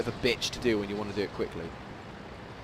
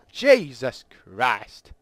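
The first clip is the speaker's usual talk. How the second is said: exasperated; 5 dB louder, much slower than usual. Real, not acted. Exasperated at long queue wait.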